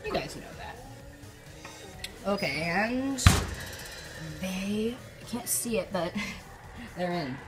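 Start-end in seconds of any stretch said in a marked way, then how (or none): none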